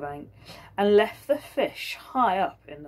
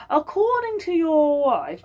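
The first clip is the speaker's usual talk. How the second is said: higher, louder, slow pointed accusation; governor's voice; 10 semitones higher, 7 dB louder, slower than usual. Acted. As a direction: lean in on him slowly.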